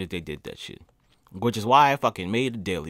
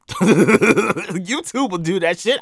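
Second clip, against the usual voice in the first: loud, slow laughing line; 6 semitones higher, 8 dB louder, much slower than usual. Real, not acted. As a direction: laugh through the words. Laughing, louder.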